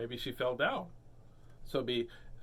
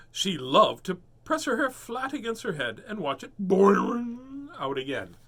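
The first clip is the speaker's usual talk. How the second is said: lively storybook read; 6 semitones higher, 7 dB louder than usual. A narrator's reading. Reading to child, lively.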